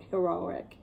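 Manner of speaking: ordinary delivery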